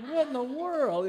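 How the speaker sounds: hammed-up outrage at a kid's question; exaggerated comic astonishment, up high; mock shock. His shocked reaction retold.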